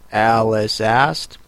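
ordinary delivery

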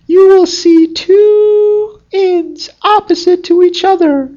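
very high, squeaky little fox voice; talking fox. Acted. Fox warning the gardener's son, in a high squeaky voice. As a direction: pipe it up high like a tiny clever fox.

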